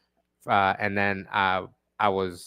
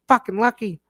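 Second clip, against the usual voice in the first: higher mock-grumble from the boss; grudging manager; 9 semitones higher than usual. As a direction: grumble it like you can't believe he got away with it. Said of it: "and he goes".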